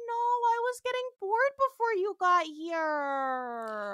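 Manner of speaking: high, loud, drawn-out petulant refusal; Trelane. Retold or imitated. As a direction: whine it out with a long last word. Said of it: "And Trelane's like".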